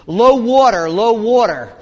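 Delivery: mock shout from a passing boat; imitated shouted warning. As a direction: holler it across to another boat. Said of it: "waving and shouting at him".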